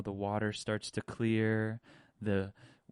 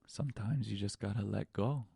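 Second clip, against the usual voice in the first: ordinary talk, nothing marked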